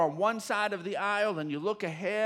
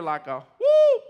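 loud high church whoop; 12 dB louder, slower than usual. Shown as a demonstration. Pastor showing the church his whoop. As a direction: let out a joyful whoop. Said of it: "if you ever hear".

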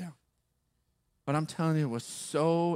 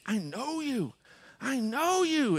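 higher, insistent mimicked repetition; super intense; 11 semitones higher, slower than usual. Stranger gripping the pastor's hand, super intense. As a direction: retell him pressing it harder, pitch climbing. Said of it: "getting super intense".

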